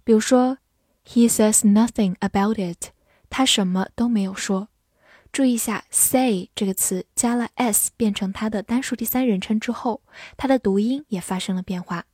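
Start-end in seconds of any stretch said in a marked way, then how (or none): none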